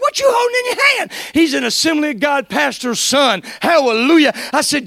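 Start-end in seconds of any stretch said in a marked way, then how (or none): 0.0-1.1 eager question. Retold or imitated. Jeremy asks eagerly on phone.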